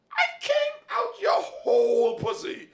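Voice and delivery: son's outraged squeal at mom; indignant high yell. Retold or imitated. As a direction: squeal it like a denied son. Son protesting mother denying him.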